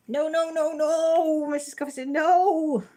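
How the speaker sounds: high, loud put-on voice for Missus Coffee's reply; playful high-pitched impersonation of a viewer; mock viewer voice. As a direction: squeal the no's up high and loud.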